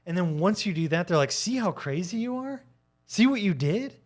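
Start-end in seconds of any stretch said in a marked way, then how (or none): 1.3-4.0 high, sneering gotcha; mocking taunt. Gaslighter taunts the victim who snapped, high and smug. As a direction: rub it in, smugly triumphant.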